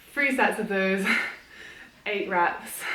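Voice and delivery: ordinary talk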